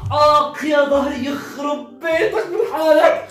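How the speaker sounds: slow groaning cry with a laugh; hammed-up pain after a hit; comic yelp of pain. Hit on the back, yelps. (الابن يتألم من الضرب بصراخ مسرحي)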